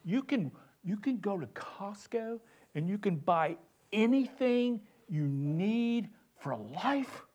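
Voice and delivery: mock-sincere French accent, high and soft. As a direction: do an earnest French accent, hushed and lilting. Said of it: "and he's sincere".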